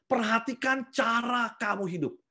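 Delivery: PERHATIKAN drawn out slow and high; slow emphatic command. Acted. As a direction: tekankan kata "Perhatikan", pelan dan tinggi. Preacher stresses key command. (ucapkan "Perhatikan" pelan dan tegas)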